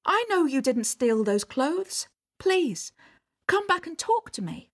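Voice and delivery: high, urgent call after her. A narrator's reading. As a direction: plead with a runaway to return. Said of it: "he called".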